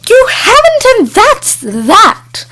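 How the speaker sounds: high, loud snap, slowing hard on the last words; bossy witch snaps at her freeloading friend; snapped. A narrator's reading. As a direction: shut the argument down flat. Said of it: "snapped Sharkadder".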